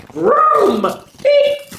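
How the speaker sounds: very high, loud vroom then beep; car vroom and beep. A narrator's reading. Reading car noises to children, very high and loud. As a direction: zoom off like a toy car. Car sounds in picture book.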